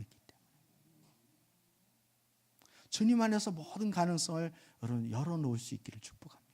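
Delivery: soft whisper, blessing the people. Real, not acted. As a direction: breathe the blessing out gently. Pastor pronounces blessing softly. (속삭이듯 축복하며)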